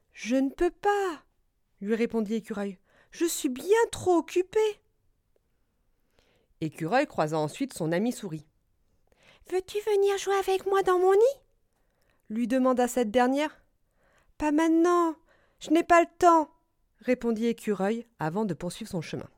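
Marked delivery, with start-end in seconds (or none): 0.1-1.2 higher, drawn-out squirrel voice; busy squirrel refusing. Acted. Écureuil refuse l'invitation. 3.1-4.6 high-pitched busy little character; Écureuil. Acted. Écureuil refuse, trop occupé. 9.5-11.4 high, louder little mouse voice; Souris. Acted. Souris invite l'écureuil. 14.4-16.5 high, loud hurried refusal; Écureuil. Acted.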